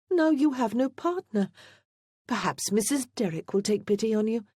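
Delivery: high-pitched theatrical sympathy; crying out. A narrator's reading. Lady Elaine laments that Wulfric has no partner, exclaiming. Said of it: "Lady Elaine cried".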